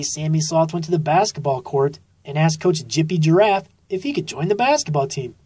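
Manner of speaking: ordinary delivery, nothing marked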